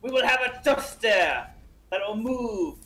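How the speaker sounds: put-on deliberate French-accented explaining. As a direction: explain the absurd plan as a Frenchman. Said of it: "in your French accent".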